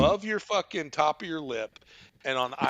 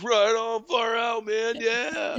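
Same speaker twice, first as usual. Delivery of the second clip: raised-pitch stoner cheering along; stoner impression; 7 semitones higher, slower than usual. Stoner volunteer cheering on the kid caller, stoner voice. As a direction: play the approving stoner, voice lifted. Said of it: "going back to the stoner".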